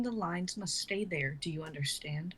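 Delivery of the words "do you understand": whispered, urgent; hushed warning. Acted. Nora warns the young man to keep the secret, whispered. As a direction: whisper it so nobody overhears.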